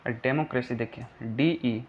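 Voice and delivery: usual speaking voice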